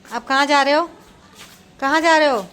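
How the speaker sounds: loud where-are-you-going call. Real, not acted. Calling after wandering child.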